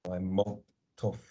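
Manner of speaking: "malt tough" drawn out slowly; slow careful patois demo. Shown as a demonstration. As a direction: say "I'm malt tough" slowly, with a long held "t" in "malt". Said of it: "there's a short one".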